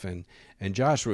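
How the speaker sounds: ordinary talk, nothing marked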